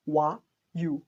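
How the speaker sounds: "Wa, you" as two separate sounds; isolated starting sounds. Shown as a demonstration. Teacher showing learners how one and university begin, isolated starting sounds. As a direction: sound out "Wa", pause, then "you". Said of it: "sounds like you or wa".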